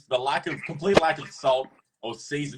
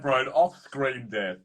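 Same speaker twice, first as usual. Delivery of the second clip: usual speaking voice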